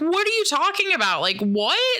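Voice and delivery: high, fast mock-bewildered question; incredulous alarm. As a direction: act out baffled alarm, pitch jumping up. Alarmed reply to screaming cellmate.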